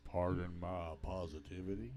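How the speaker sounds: low, loud cowboy voice; cowboy impression. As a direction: drop low and do John Wayne. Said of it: "my cowboy impression".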